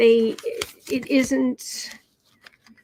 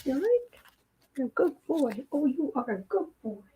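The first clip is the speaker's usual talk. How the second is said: praising pet; 4 semitones higher, 7 dB quieter than usual. Real, not acted. Reader praising her cat while stroking him, soft cooing.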